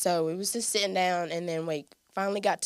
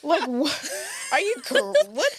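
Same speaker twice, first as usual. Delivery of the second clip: much higher, louder stunned cries; retold panic, near shrieking; shocked disbelief; 12 semitones higher, 7 dB louder than usual. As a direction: squeal it like the floor just dropped. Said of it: "'Cause I was like".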